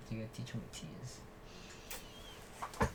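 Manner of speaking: soft whispered coaching aside; whispering. Real, not acted. Dad coaching child for video.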